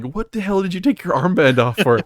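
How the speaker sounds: high, loud mock outrage; incredulous scolding. Squad reacting to removed armband, incredulous. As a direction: imitate an exasperated squad.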